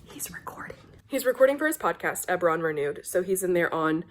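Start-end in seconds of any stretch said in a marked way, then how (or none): 0.0-0.8 hushed, fully whispered aside. Real, not acted. Vlogger near husband's podcast recording, whispering. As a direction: whisper so the recording isn't disturbed.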